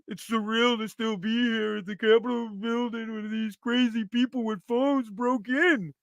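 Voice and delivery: soft, put-on trauma voice; hushed mock awe, rising at the end; mock survivor. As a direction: parody a shaken survivor recounting it. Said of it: "He survived".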